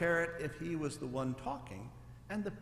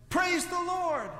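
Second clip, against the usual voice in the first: high, loud, slow thanksgiving; relieved praise; 13 semitones higher, 7 dB louder, slower than usual. A narrator's reading. Relieved preacher calls up to heaven, high and loud.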